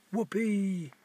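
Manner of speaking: high-pitched, drawn-out whoop; children's whoop of glee; gleeful shout. A narrator's reading. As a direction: cry out in delight, voice jumping up. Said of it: "shouted the welly wanderers".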